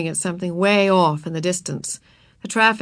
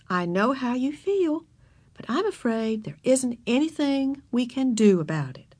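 sympathetic but firm; 5 semitones higher than usual. A narrator's reading. Teacher refusing the girl kindly, sympathetic but firm. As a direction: let a child down kindly but firmly. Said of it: "was sympathetic but firm".

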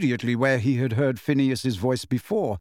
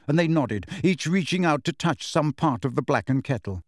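ordinary delivery

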